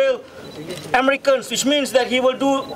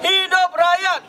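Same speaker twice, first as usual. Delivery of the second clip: fiery revolutionary speech; 6 semitones higher than usual. Real, not acted. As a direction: chant it out to the crowd. Leads protest chant call.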